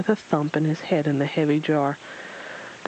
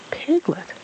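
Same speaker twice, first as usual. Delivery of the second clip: ordinary delivery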